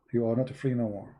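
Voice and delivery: usual speaking voice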